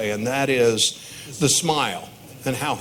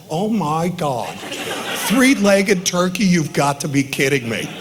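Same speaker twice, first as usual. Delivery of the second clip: gullible awe; 4 semitones higher, 3 dB louder than usual. Retold or imitated. Dad imitating his daughter falling for the turkey joke, laughing awe. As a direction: imitate a kid totally buying it, wide-eyed. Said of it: "And Andrea's like this".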